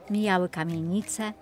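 ordinary delivery

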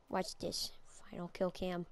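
hushed, breathy watch this; whisper. Real, not acted. Gamer whispering to viewers before the kill cam, whisper. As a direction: lean in and whisper it.

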